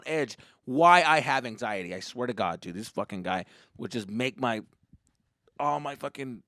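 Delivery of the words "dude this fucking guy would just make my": ordinary delivery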